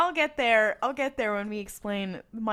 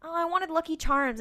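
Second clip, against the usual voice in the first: higher, louder mock child's complaint; whining little kid; 8 semitones higher, 5 dB louder than usual. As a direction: lightly imitate a disappointed little boy. Retold six-year-old asking for cereal.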